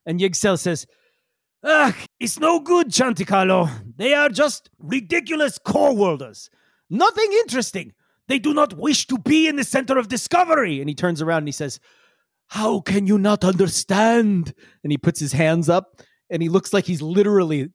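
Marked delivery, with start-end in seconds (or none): 1.6-10.8 put-on high, animated accented character voice; exasperated Yigsel. Yigsel complaining about the core worlders, exasperated voice. 12.5-14.5 slow, emphatic plea in a put-on accent; entreating Yigsel. Yigsel with hands up, entreating the crew. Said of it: "entreating you".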